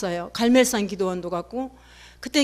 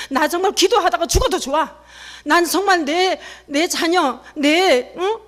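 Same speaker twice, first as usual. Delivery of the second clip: impassioned preaching; 6 semitones higher, 4 dB louder than usual. Acted. Impassioned prayer at sermon climax.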